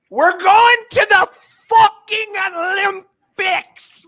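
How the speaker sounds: high, loud, thrilled yell; real excited yell; excited shout. Real, not acted. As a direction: shout the news with pure joy. Excited Olympics announcement.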